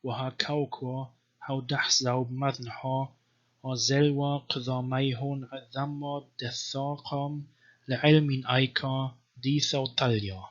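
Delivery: slow, measured reading of Syriac Gospel text; chanted-style Syriac reading; scripture recitation. Shown as a demonstration. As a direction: recite the verse as sacred text. Reads Syriac Gospel aloud.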